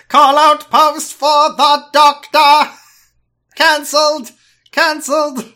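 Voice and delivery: high, loud, drawn-out Dalek shouting; mock Dalek proclamation at full volume. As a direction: screech it slowly like an outraged Dalek. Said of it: "how the Daleks talk so slowly".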